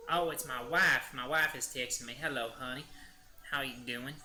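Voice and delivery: Southern US husband greeting his wife; Southern accent. Acted. Streamer reacting to his wife's text, Southern accent. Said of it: "accents a bit".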